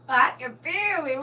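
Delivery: slow, laughing through a fake cry; laughing mock sob. Real, not acted. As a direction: break into laughter while weeping. Overcome, laughing repeat.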